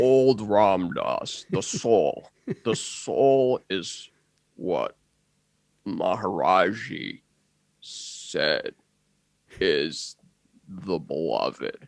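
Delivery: put-on very slow, low, soft elderly guru; long pauses, deep and hushed at the end; later-life Ram Dass. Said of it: "a later in life Ram Dass impression".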